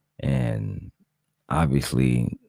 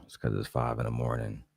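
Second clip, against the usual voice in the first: softer, hushed early-morning talk; quiet at night; 4 semitones lower, 11 dB quieter than usual. Real, not acted. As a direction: keep it low so the house sleeps. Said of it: "If y'all hear me talking low".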